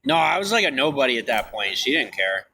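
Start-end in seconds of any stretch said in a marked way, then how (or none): none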